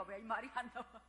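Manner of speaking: usual speaking voice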